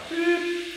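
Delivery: short, very high beep sound; imitated beep. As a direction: chirp it like a phone tone. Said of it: "the voice would say".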